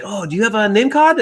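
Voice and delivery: high-pitched, eager polite question; eager questioner. Retold or imitated. Quoting eager Chinese contacts asking.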